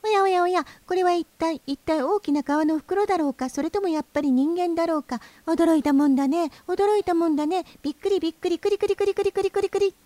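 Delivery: very high, fast chattering bird voice; high, fast, breathless bird chatter ending in trills; hurried reed warbler. A narrator's reading. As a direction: rattle it off like an excited little bird. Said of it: "ヨシキリが急いで言いました".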